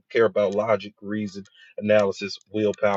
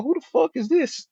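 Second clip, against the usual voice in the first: high, startled cry of disbelief; staring at himself out of the shower, shocked; shocked surprise. Retold or imitated. Seeing himself in the mirror, he cries out in shock. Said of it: "and I go".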